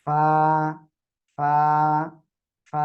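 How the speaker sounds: ফা with a strong puff of air; breathy fa. Shown as a demonstration. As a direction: push air out on ফা. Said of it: "দিয়ে দেখেন বাতাস বের হচ্ছে কিনা".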